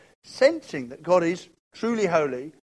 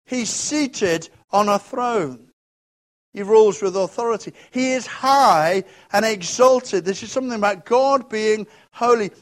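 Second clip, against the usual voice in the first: preaching at full force; 5 semitones higher, 7 dB louder than usual. Acted.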